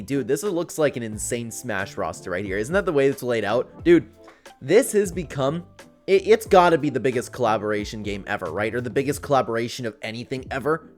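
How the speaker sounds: normal talking voice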